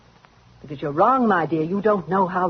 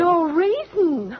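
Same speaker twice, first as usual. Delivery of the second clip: higher and slow, scornful disbelief; a slow sneering echo; mocking echo; 9 semitones higher, much slower than usual. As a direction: throw her words back at her. [name] mocks Helen's denial.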